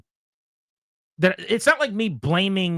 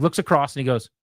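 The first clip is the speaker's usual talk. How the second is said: ordinary talk, nothing marked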